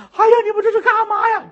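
high, fast, panicked protest; a startled nun squeals as the chain drops; alarmed cry. Acted. Chained nun cries out to the guards, high and frightened.

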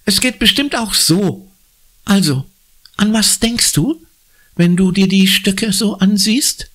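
put-on high, bright-eyed girl coaxing a friend; eager girl. Anna prods Conny to predict, eager and high. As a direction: coax the answer out of her. Anna's character voice.

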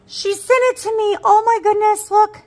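high, loud squeal of delight; excited squeal. Real, not acted. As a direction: squeal with joy over the gift.

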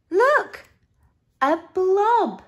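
high, loud storybook gasp of wonder; excited discovery. A narrator's reading. Narrator showing children a surprise, excited. Exclaiming at a new sight.

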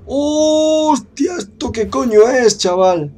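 high startled yelp, then loud; shocked cry that jumps up high; startled shriek. Real, not acted. Sudden monster jump scare. (grito de susto)

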